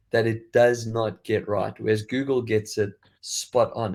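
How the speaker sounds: ordinary talk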